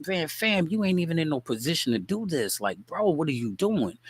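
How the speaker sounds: put-on pitched high, talking down a drunk; incredulous brush-off. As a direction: scoff at him like he's out of his league. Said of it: "you can just look at him and tell him".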